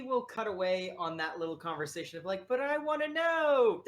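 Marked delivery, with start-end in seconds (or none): 2.5-3.8 high, loud mock whine; whiny pleading. Adventurer pestering for the cookie secret, whiny. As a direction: beg like a nagging kid, hammed up.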